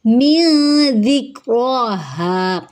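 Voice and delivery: من with a hidden nasal n before ذ; tajweed recitation. Shown as a demonstration. Teacher reciting for students, very slow. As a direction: read من ذکرہا very slowly, n hidden. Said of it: "من پھر سے اخفا ہو رہا ہے".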